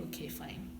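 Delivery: breathless resigned whisper; whispered okay fine. Retold or imitated. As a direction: barely voice it, resigned. Resigned agreement.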